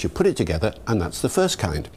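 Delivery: ordinary delivery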